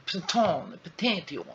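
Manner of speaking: high, playful garbled tries at "Pantheon"; fumbled imitated pronunciation. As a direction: mangle "Pantheon" with a French-style T for the TH. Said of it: "how did she pronounce that?".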